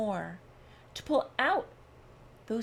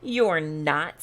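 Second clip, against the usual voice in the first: short, louder snap, pitched lower; snapped retort; 6 semitones lower, 5 dB louder than usual. A narrator's reading. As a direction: bite the words off sharply. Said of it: "snapped the rabbit".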